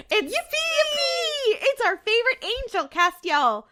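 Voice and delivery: high-pitched squeal of delight; gleeful fan squeal, easing down on the name; giddy cheer. Real, not acted. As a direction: cheer the favourite's entrance in a high voice. Cheering the favourite angel's arrival.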